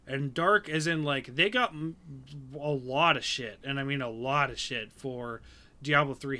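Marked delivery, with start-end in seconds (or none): none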